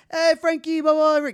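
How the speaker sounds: loud, high imitation of a big shot; hearty hailing. Big shot greeting the kitchen staff, hearty imitation. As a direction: hail everyone in a put-on booming greeting.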